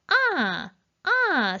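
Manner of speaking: two 아, each starting high and falling; Mandarin fourth tone. Shown as a demonstration. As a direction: throw 아 up high and drop it, twice. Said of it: "사 성".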